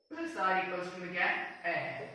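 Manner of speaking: usual speaking voice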